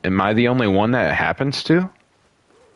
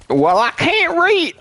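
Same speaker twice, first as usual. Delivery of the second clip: mock hillbilly. Replaying joke.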